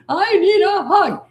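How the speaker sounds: high, loud, stretched-out mock demand; tantruming child. Child trying to control parent in a tantrum, mock wail.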